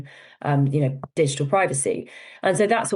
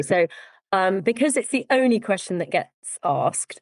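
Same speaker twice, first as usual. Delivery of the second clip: ordinary talk, nothing marked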